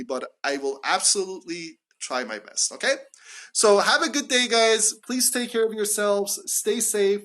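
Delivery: normal talking voice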